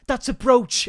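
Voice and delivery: high, loud insistence; hammed-up outraged denial; mock indignant protest. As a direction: protest it like a caught liar. Acting shoplifter's indignant lie.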